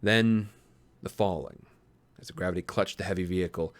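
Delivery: low, hushed storytelling voice; story narration. A narrator's reading. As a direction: read it like a dark tale, drawing it out. Reads a dark story aloud.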